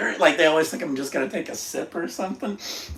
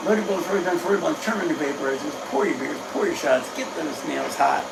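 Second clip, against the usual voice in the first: brisk rattled-off list, slowing on the last phrase; quicker rhythmic run-through; 4 semitones higher than usual. Shown as a demonstration. Host varying the routine for viewers, quick and rhythmic. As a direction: run the instructions together in rhythm. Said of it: "I do change it up a lot".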